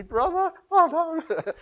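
fronting relatives, a high hammed-up zealot cry; mock zealot.

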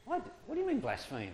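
baffled questioning voice; puzzled quote. Retold or imitated. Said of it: "And they go".

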